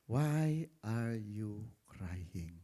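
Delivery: a lightly voiced gentle rebuke from above; the Lord gently asking Moses why he cries; God's voice. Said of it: "Sabi ng Lord sa kanya".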